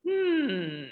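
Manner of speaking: ordinary talk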